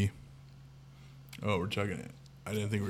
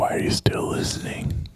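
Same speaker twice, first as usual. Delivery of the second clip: soft, voiceless whispered question; mock baffled whisper; 6 dB quieter than usual. Podcaster questions whoever is still listening, mock baffled whisper. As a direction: whisper it in playful disbelief.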